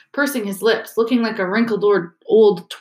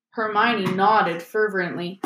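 ordinary delivery, nothing marked

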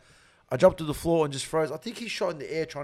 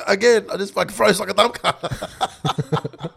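usual speaking voice

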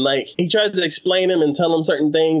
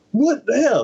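high baffled exclamation; incredulous; 10 semitones higher than usual. Retold or imitated. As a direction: react in disbelief. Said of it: "I'm just like".

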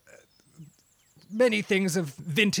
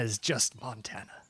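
whispering; 6 semitones lower, 7 dB quieter than usual. Real, not acted. Aside remark in interview.

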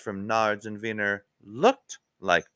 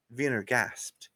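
usual speaking voice

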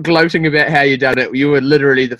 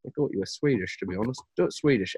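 mock quiet director; 8 dB quieter than usual. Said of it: "And they're like".

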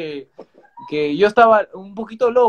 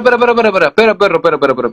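louder, calling out over him; loud interruption; 11 dB louder, much faster than usual. Real, not acted. Host stopping his friend mid-story, calling loud.

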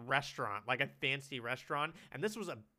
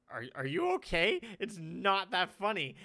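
higher, louder imitated exasperation; imitated concern; 6 semitones higher, 8 dB louder than usual. Onlooker to someone losing their breath laughing, imitated exasperation. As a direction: quote a baffled onlooker, raised and loud. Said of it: "would always be like".

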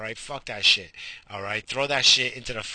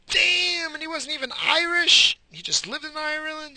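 shocked surprise. Real, not acted. Reading that Patrick wasn't Irish, high and shocked. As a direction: jolt up high at the news.

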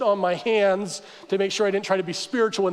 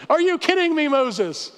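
high-pitched mock outrage; acted exasperated protest; indignant complaint; 5 semitones higher than usual. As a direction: complain incredulously, starting high and falling on the name. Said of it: "They complained".

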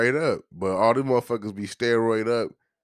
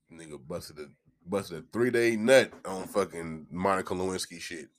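usual speaking voice

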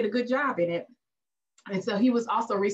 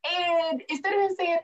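ordinary talk, nothing marked

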